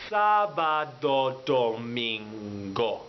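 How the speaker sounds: "Sábado, domingo" said very slowly. Shown as a demonstration. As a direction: stretch each syllable of "Sábado, domingo". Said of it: "a little bit slower".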